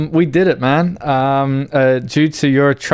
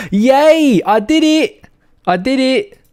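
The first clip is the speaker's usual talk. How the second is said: excited cheering; 12 semitones higher, 4 dB louder, slower than usual. Real, not acted. Finally succeeds.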